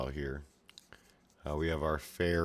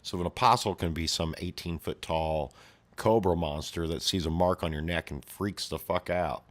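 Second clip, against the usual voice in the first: ordinary delivery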